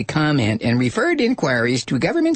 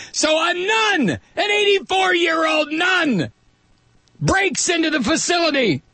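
excited shout; 13 semitones higher than usual. Real, not acted. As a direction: blurt it out in disbelief. Host marvels at nun's break-in.

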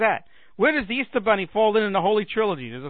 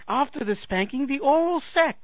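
high-pitched film-character impression, rising at the end; Zoot; 7 semitones higher than usual. Comic repeating the Monty Python bit, high character voice. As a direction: lighter reprise of the eager cry. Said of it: "I can just see Zoot from Monty Python".

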